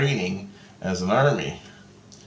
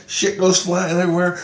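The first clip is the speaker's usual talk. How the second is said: usual speaking voice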